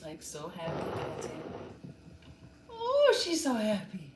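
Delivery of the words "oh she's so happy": cooing at dog. Real, not acted. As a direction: gush over the happy dog.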